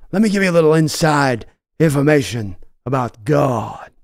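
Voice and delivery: intense devilish Pacino drawl. Acted. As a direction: preach it like a gleeful devil. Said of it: "I love that monologue".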